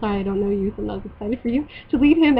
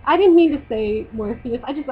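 ordinary talk

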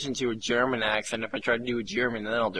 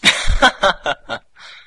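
loud, high cackling laugh; long peal of high laughter; 7 semitones higher, 13 dB louder than usual. Real, not acted. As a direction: burst into a big real laugh. Laughs at Motion Plus joke.

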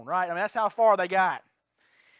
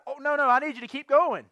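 mock loud, higher-pitched protest; imitating getting loud; 5 semitones higher, 4 dB louder than usual. Hitchhiker to driver in the truck, imitated loud insisting. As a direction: raise pitch and insist, as a retold demand. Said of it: "He's getting loud".

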